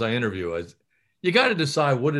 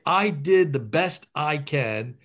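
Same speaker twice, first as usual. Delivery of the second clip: confident competitor; 6 semitones higher than usual. Retold or imitated. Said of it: "at least that you say".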